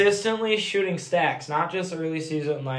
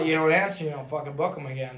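normal talking voice

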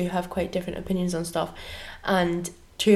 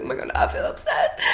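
laughing mock upset; 3 dB quieter than usual. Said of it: "And I was like".

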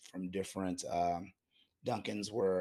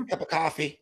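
put-on laughing, half-hearted Boston accent, pitched up; a clumsy try at a Boston accent through laughter; bad Boston accent; 8 semitones higher, 7 dB louder than usual. Co-host jokes about Dunkin' orders in a bad Boston accent. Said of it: "my Boston accent was horrible".

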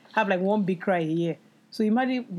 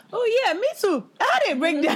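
high, bright-sounding fake cheer, much higher and louder; mock cheerful; 13 semitones higher, 4 dB louder than usual. Telling a friend about a breakdown, mock cheerful. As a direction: chirp it out like nothing happened. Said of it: "I was like".

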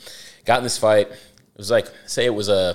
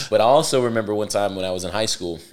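ordinary talk, nothing marked

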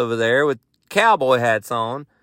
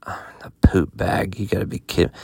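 muttered complaint; 6 semitones lower, 4 dB quieter than usual. Retold or imitated. Grumbling at wife's reminder.

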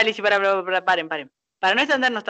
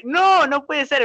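retold cry in the cinema; 7 semitones higher, 4 dB louder, slower than usual. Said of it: "ahí grité y dije".

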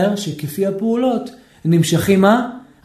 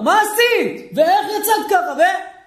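high, scolding mock yell; a husband blowing up, high and sharp; angry shouting; 9 semitones higher, slower than usual. Husband angry at his wife, in a high mock scolding yell. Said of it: "הוא מפעיל את הצעקות".

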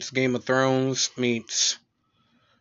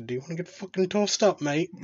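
put-on British accent; 5 semitones higher than usual. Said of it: "use a British accent".

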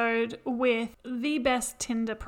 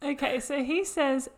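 normal talking voice

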